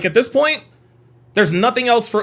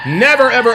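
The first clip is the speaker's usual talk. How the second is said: NEVER punched louder and higher; emphatic never; 6 semitones higher, 6 dB louder than usual. Real, not acted. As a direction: hit the word NEVER hard. Emphatic declaration.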